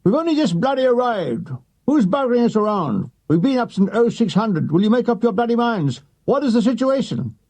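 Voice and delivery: a hammed-up furious officer yelling down the radio; angry shout. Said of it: "he shouts".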